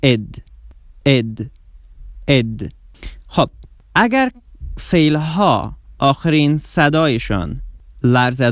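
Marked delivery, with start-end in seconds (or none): 0.0-2.7 "اد" said as its own full syllable. Shown as a demonstration. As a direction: give "اد" a clear extra syllable. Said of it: "و سیومین صدایش".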